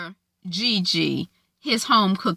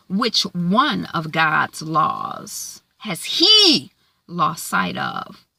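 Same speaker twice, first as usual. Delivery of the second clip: slow pointed challenge; 3 semitones lower, much slower than usual. Acted. Lady May confronts Lionel icily.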